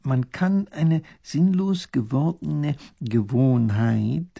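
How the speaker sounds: stretched words with thinking pauses; slow, pondering. A narrator's reading. Professor explaining his thought, slow and deliberate. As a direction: work the idea out word by word. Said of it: "sagt Professor Pfiffikus langsam und überlegend".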